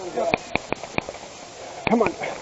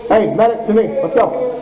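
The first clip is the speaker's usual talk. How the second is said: calling for medic; 7 semitones higher than usual. Real, not acted. Calling medic under fire.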